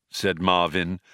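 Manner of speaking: ordinary delivery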